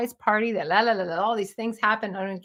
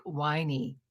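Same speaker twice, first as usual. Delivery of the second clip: ordinary delivery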